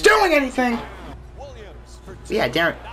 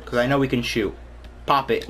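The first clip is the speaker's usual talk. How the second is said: usual speaking voice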